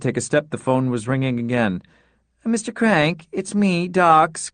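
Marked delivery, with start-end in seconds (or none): 2.4-4.5 struggling voice. Acted. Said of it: "came a struggling voice".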